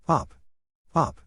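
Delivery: ordinary delivery, nothing marked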